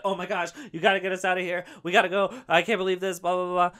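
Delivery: imitating a panicking mother stuck in an elevator, fast and high; panicking mom. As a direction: rush it like she's freaking out. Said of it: "She's like".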